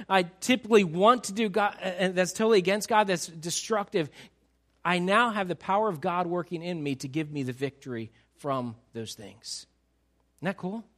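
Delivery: ordinary talk, nothing marked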